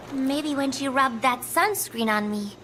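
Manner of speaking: ordinary talk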